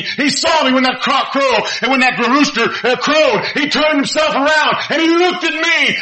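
Peter's confession. Acted. Preacher voicing Peter confessing his denial, a hint of grief. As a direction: confess it as the broken disciple. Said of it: "He says".

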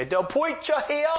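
high, drawn-out loud call; imitated simple older man calling out; Herbie. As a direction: holler it slowly in an odd, high-pitched voice. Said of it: "in a very, very loud voice".

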